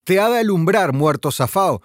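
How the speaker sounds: ordinary delivery, nothing marked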